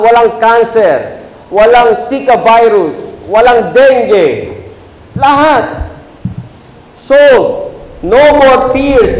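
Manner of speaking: high, slow, fervent proclamation; preaching at full force. Acted. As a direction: lift them up with the promise of heaven. Climax of sermon on heaven.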